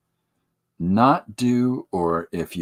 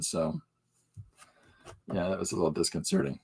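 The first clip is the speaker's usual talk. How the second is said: usual speaking voice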